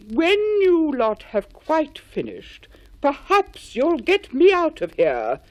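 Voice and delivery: slow, loud exasperated call. A narrator's reading. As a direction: shout down impatiently from the tree. Henry calls from the tree.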